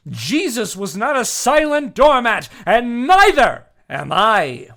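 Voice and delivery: impassioned rant swelling to a full shout; ranting tirade. Acted. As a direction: read the letter as a rising indignant sermon, hammering the last lines. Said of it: "back to Jack".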